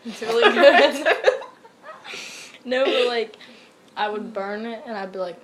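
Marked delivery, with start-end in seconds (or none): 0.1-1.2 slow, loud, high through a laugh; laughing praise. Real, not acted. Praising crab apples to friend, slow laughing delight. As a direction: savor it while laughing.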